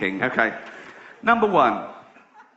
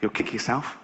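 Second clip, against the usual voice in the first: lower, softer knowing tease; low smug hare; 5 semitones lower, 7 dB quieter than usual. Retold or imitated. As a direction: savour the secret quietly. Hare teasing.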